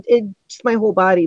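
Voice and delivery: ordinary talk, nothing marked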